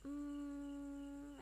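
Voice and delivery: normal talking voice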